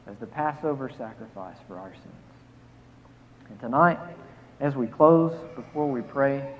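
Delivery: ordinary talk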